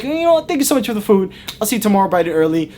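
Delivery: high-pitched forced cheer, easing down; nervous brush-off. Retold or imitated. Spooked guest cuts the warning short, high and overly cheery. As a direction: squeak out hasty thanks to end the talk.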